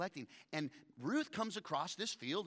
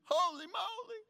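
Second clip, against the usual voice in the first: squealing Naomi; 5 dB louder than usual. Retold or imitated. Naomi squeals with delight at Ruth's news, very high and loud. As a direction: squeal it like a giddy matchmaker. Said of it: "That's Hebrew".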